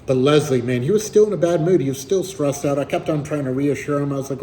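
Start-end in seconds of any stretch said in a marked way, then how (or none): none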